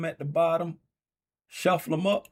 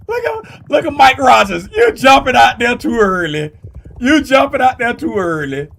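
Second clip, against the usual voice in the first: loud teasing; 8 semitones higher, 14 dB louder than usual. Pretend. Teasing racers, laughing.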